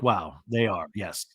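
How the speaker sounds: low, deadpan awe. Retold or imitated.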